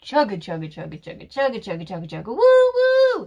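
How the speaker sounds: chug along then blow the whistle; train imitation. Shown as a demonstration. Showing the English train sound to viewers. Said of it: "which in English you would say".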